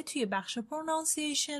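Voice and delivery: ordinary talk, nothing marked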